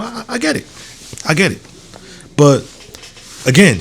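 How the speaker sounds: normal talking voice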